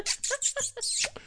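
imitated clicking cat call; quick repeated clicks to call a cat; cat call noise. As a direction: mimic grandma calling the cat with clicks. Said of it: "her cat call, like".

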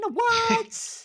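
high, loud incredulous no; genuinely stunned, voice jumping up; shocked disbelief. Real, not acted. Disbelief at Naboo claim.

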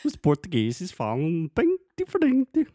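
high, loud put-on European Portuguese accent; mock European Portuguese accent. Husband teasing his wife with a high, loud mock European Portuguese accent. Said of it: "A voz, a voz ficando mais fina".

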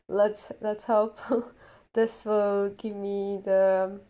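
normal talking voice

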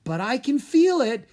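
building from calm to high and loud; rising excitement. A narrator's reading. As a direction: let the thrill climb as the link grows. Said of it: "his voice rising with excitement".

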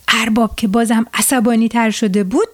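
ordinary delivery

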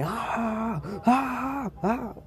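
series of short, very high wails; high-pitched hammed-up screams of a man being shot; mock actor's scream. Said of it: "el güey grita así de". (gritos agudos y exagerados de mal actor)

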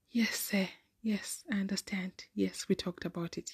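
whispered, timid yes sir; meek whisper. Retold or imitated. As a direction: answer in a scared little whisper. Meek reply to teacher.

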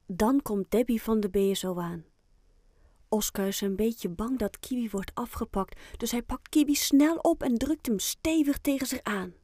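ordinary delivery, nothing marked

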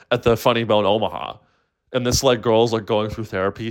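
ordinary talk

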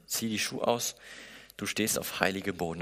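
hushed echo of the command. Shown as a demonstration. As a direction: repeat it softly, almost to yourself. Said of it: "repeat of God's words".

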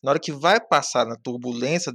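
ordinary delivery

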